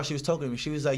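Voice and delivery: normal talking voice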